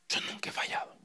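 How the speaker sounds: a bitter whisper on "Yo nunca he fallado"; barely voiced, resentful whisper; whispered complaint. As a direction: hiss it softly, hurt and proud. Son's words, whispered.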